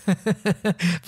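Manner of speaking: short chuckle at one's own joke; self-amused little laugh. Real, not acted. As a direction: let out a light, quick laugh. Chuckling at own joke about himself.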